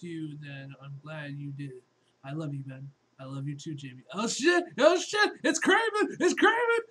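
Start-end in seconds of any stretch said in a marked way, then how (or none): 4.1-6.8 rising, ever louder alarmed yell; excited shout. Acted. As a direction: build the panic with each cry. Character spots Kraven, cries out.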